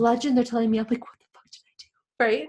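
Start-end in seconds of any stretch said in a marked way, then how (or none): 1.0-1.9 whispered, horrified; whispered dismay at her own antics; whispered panic. Retold or imitated. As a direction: hush it out in shock. Hearing she is a legend.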